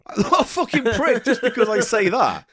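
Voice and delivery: high, indignant through laughter; amused outrage; laughing protest. Real, not acted. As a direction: protest loudly while cracking up. Protesting being called a prick, laughing.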